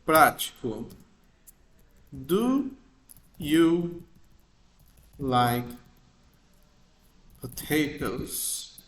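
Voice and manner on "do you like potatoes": slow English question, spaced word by word; slow example question. Shown as a demonstration. Teacher reading a practical example question slowly to students. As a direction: read the question out slowly for learners. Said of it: "exemplo prático".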